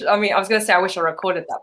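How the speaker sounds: ordinary talk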